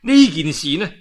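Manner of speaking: ordinary delivery, nothing marked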